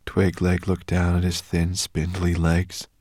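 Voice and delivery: plain reading voice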